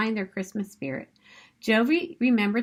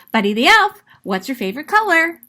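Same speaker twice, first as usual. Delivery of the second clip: loud, fast, cheery phone greeting; excited Buddy; 6 semitones higher, 9 dB louder, faster than usual. Acted. Buddy answers office phones, bursting loud and eager. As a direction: chirp it down the phone, eager and quick.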